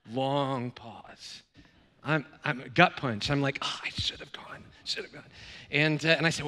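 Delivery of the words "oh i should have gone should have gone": whispered regret, no voice at all; dismayed whisper. Retold or imitated. Colleague whispering his regret on hearing of the knife. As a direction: breathe the words out in shocked dismay.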